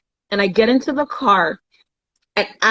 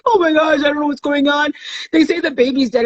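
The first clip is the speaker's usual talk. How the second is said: tearful panic; 7 semitones higher than usual. Retold or imitated. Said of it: "in tears".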